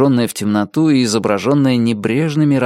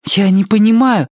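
louder, higher burst of bewilderment; confused protest; 11 semitones higher, 5 dB louder than usual. A narrator's reading. Bewildered character cut short by a shush, confused protest. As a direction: protest that you can't understand.

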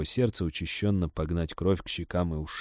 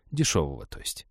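embarrassed mumble; 5 semitones lower, 5 dB quieter than usual. Acted. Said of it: "Лесник замялся".

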